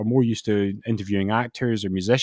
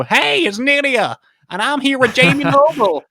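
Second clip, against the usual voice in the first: hyped-up Nydia; 14 semitones higher, 6 dB louder than usual. Retold or imitated. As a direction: ham up the big wrestler greeting. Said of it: "That's what you want".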